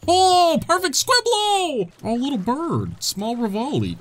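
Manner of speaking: high, slow, delighted squeal of the name; overjoyed high-pitched cry. Real, not acted. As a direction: squeal it like you just found a lost pet. Delighted at finding Korok.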